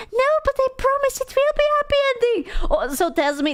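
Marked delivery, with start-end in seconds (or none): 0.1-2.4 high, whiny mock pleading; mocking the story guy. Streamer mocking the story guy pestering her, high and whiny. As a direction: imitate the pest in a squeaky high voice. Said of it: "He's like".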